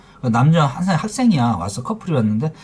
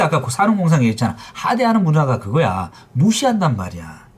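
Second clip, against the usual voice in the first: ordinary talk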